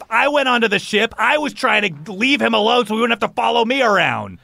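heated, high-pitched rant; angry shouting. Acted. Pally defends leaving little brother behind, angry. Acted quarrel between brothers.

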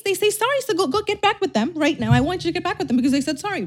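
high, sing-song sarcastic advice; mock naive urging. Mocking advice to viewers to take exes back, sarcastic and high. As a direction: push it high, as if cheerfully talking someone into it.

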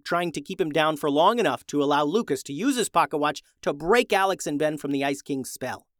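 usual speaking voice

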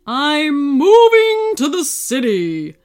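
loud, slow, drawn-out proclamation; excited shout. Acted. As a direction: announce it grandly for all the mountains to hear. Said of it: "he shouted so loud ... with excitement".

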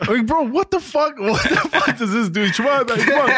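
very high, laughing disbelief; mock outraged protest. Son punched by dad as a boost, mock outrage. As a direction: protest in comic bafflement, pitch shooting up.

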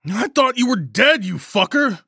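high, loud outburst of fury over relief; furious yell. A narrator's reading. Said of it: "I yelled, fury surging over the relief".

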